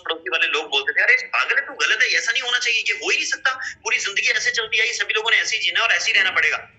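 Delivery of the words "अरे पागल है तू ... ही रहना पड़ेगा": high, scornful neighbour mocking a madman; scolding neighbours. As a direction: neighbours scoffing at the man who wants change, high and scornful. Neighbours mock the innovator.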